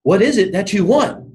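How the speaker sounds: high-pitched royal questioning; puzzled king. Retold or imitated. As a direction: ask it as a king pleading for an answer. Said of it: "And he goes".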